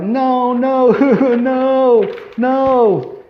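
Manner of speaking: high, strained repeated no's; a hammed-up wail of disbelief; anguished denial. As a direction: refuse to believe it, voice cracking. Brad Pitt's horrified denial.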